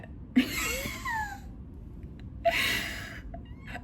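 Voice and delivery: very high, squeaky laughing fit; long, high-pitched fit of giggles; helpless laughter. Real, not acted. Reader breaks up at her own acting, laughing helplessly.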